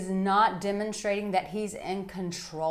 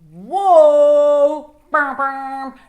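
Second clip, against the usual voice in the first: loud, higher startled whoa; hammed-up surprise; mock shock; 6 semitones higher, 14 dB louder, much slower than usual. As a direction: act out a jolted woman. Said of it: "it's like".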